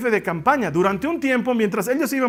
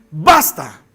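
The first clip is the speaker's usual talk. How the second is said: sharp loud low command; Paul furiously silencing the girl; 5 semitones lower, 7 dB louder than usual. Acted. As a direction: stop her cold with one word. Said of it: "furioso le dice".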